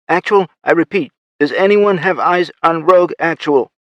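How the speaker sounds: high, loud and urgent; panicked call over the radio; frantic radio call. Acted. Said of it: "The faceless voice sounded frantic".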